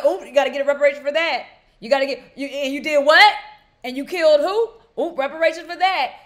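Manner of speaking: indignant disbelief. Acted. Host reacting aloud to the book's wrongs, indignant disbelief.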